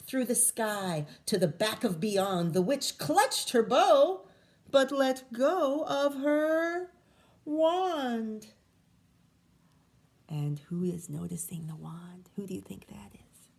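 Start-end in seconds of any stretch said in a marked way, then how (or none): 2.5-8.5 dramatic. A narrator's reading. Storm builds, wand falls. 10.3-13.5 whisper. Real, not acted. Whispering question to children.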